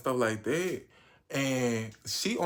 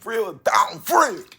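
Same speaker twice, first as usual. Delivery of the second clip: loud emphatic; 10 semitones higher, 12 dB louder, much slower than usual. Real, not acted. Emphatic praise of friends.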